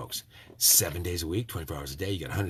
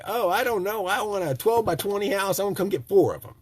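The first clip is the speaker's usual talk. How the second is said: naive customer; 10 semitones higher, 3 dB louder than usual. Pretend. Imagined naive customer answering.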